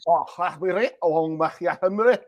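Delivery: playful put-on Scottish brogue; Scottish accent. Host doing a mock Scottish accent at the guest's request. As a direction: have a go at broad Scots, amused. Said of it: "Can you do Scottish?".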